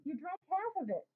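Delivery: high, aggrieved complaint; indignant boy scolding his friend. A narrator's reading. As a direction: protest that your friend took too much. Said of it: "I say indignantly".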